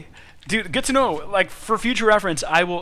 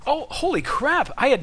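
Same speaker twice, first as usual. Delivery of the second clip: surprised; 7 semitones higher than usual. Real, not acted. Surprised by dev news.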